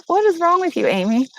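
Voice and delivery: a mock rebuke aimed at herself, pitched high; mock exasperation. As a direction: scold yourself in playful disbelief. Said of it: "I'm like".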